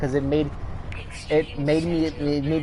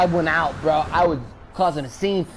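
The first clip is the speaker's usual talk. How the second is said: ordinary talk, nothing marked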